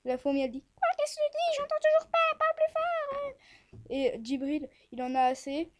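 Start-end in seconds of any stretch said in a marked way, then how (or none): 0.8-3.8 very high squeaky little ant; tiny ant voice. Acted. The ant answers Djibril, high-pitched and puzzled. Ant still can't hear.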